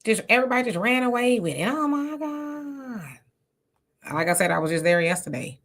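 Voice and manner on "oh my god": slow, drawn-out, softer disbelief; drawn-out exasperated oh my God. Real, not acted. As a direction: let it drag out in dismay. Exasperated at rumor.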